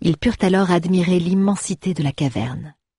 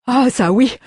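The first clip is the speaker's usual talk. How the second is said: much higher, louder eager dwarf; excited dwarf; 8 semitones higher, 4 dB louder than usual. Acted. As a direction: burst out greedy and thrilled.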